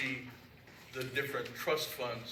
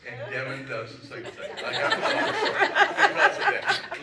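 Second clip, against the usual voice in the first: laughing through the joke, voice jumping much higher and slowing; 14 semitones higher, 12 dB louder than usual. Real, not acted. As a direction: chuckle the words out at his own joke. Joking about being bossed around.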